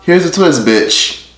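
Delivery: ordinary talk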